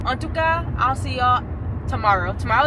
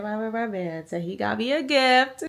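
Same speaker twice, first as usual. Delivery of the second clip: ordinary delivery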